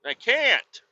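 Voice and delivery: high-pitched comic whining; worked up, voice pitched high; frustrated whine. Retold or imitated. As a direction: plead it in exasperation.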